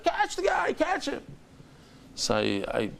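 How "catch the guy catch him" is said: very high mock yell at normal volume; retold screaming. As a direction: imitate frantic pursuers calling out. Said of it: "and they're screaming".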